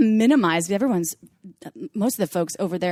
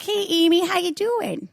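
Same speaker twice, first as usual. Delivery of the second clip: high, hammed-up Midwest hello; thick Midwest accent; 10 semitones higher, slower than usual. Talking to people with a thick Midwest accent, high mock Midwest. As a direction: greet a friend in a broad Midwest accent. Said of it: "people that have a thick Midwest accent".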